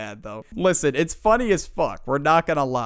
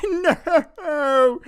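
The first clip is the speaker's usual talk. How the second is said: wailing no; 12 semitones higher, 4 dB louder than usual. Retold or imitated. Kid breaking down.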